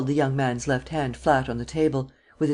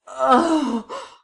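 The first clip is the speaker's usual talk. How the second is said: sharp high cry of pain; 11 semitones higher, 4 dB louder than usual.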